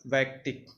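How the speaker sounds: usual speaking voice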